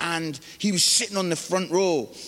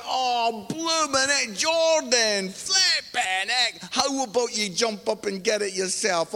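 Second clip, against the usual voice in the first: high, drawn-out comic scolding; mock exasperation; 5 semitones higher, slower than usual. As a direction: imitate a dad fuming at his son's cheek. Father scolding son driving home.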